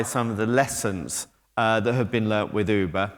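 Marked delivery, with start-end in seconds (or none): none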